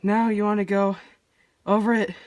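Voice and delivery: breathless from running upstairs; panting between phrases; out of breath. Real, not acted. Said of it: "Sorry, I'm out of breath".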